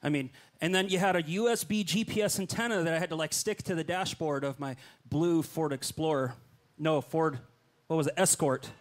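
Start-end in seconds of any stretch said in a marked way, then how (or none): none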